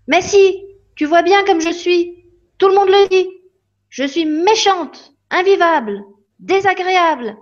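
put-on a girl insisting to her fairy that she is mean; upset little girl. Child Lily voiced by narrator.

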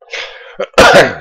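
single sharp cough from a cold. Real, not acted. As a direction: cough hard once, chest cold. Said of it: "je tousse un petit peu trop". (toux sèche)

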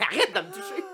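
squeaky mock voice. Joking touch me then stop.

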